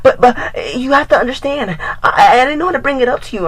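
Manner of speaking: high, pleading girl voice, hammed up; mock crying ex. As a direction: imitate her whiny tearful plea. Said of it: "And she's starting to cry".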